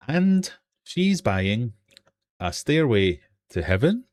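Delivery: spoken line with long pauses, rising at the end; slow lyric recital. Shown as a demonstration. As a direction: recite it very slowly, piece by piece. Quiz host recites lyric unsung.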